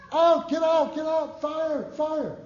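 high, loud alarmed yelling; frantic warning shout. Retold or imitated. Visitor yelling at the homeowner to flee the fire, high frantic shout. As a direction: shout the alarm to save them. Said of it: "Same fact, just how you express it".

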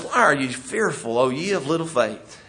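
Jesus calmly soothing the panicked disciples; gentle rebuke. Acted. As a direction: ease their fear tenderly. Jesus calmly answers frightened disciples.

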